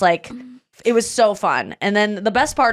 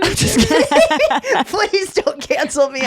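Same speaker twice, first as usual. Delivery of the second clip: much higher, slow laughing plea; laughing apology; 8 semitones higher, slower than usual. Real, not acted. Comedian taking back a joke to the audience, laughing and higher. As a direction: giggle it out pleading. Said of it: "kidding remark".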